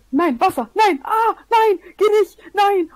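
high, loud panicked cries; panicked yelling. Real, not acted. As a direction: yell in real panic. Water floods his build in game.

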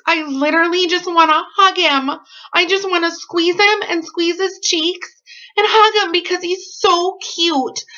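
high, gushing voice full of affection. Real, not acted. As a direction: coo over him like a cute puppy. Gushing over cute friend.

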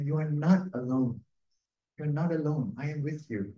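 normal talking voice

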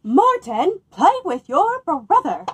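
loud, high call across the house. Acted. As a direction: call the boy from another room. Mother calling her son.

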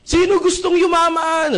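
slow, high, loud rallying call; hype shout. Retold or imitated. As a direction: pump up the crowd. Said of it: "Nagsisigawan yung mga tao".

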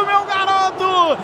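normal talking voice

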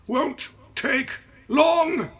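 loud, slow, high strained shout. A narrator's reading. Driver shouting while hauling the carcass, strained. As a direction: shout mid-heave.

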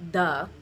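"the" said as D plus schwa, no puff; a D with no air, then schwa, for "the"; unaspirated D. Shown as a demonstration. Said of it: "consonants that are unaspirated".

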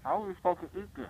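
exasperated outburst. Real, not acted. Loud repeat, staring at wad.

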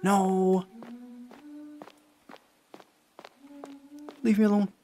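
high, loud panicked cries; panicked protest. Real, not acted. Player begs the chasing woman to leave, panicked. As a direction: cry out to fend off a pursuer. Player being chased in game.

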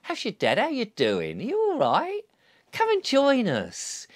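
rising, lilting, inviting; friendly invitation to a uniformed worker; welcoming invitation. Retold or imitated. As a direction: coax them gently to join. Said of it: "and saying".

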